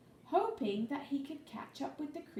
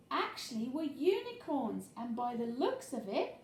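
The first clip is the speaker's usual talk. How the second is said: ordinary delivery, nothing marked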